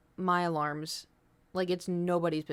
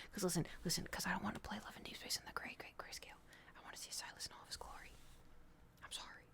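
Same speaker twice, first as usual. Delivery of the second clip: whisper; 18 dB quieter, faster than usual. Real, not acted. Confessing game crush.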